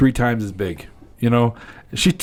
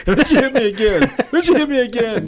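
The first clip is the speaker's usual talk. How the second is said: high, laughing comic complaint; mock outrage; 13 semitones higher, 3 dB louder than usual. Man tells friends a woman smacked him, high laughing mock outrage.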